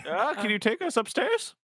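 put-on high old lady voice, player copying. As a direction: slip into the GM's old lady voice. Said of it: "I did your voice".

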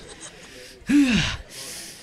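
long, heavy breath out; anxious in-character sigh; nervous exhale. Acted. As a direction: blow out a breath to steel yourself. Robin steels himself to order.

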